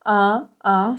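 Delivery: un, un with air through nose and mouth; isolated nasal vowel un. Shown as a demonstration. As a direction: say un, nasal and open. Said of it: "c'est un son nasal".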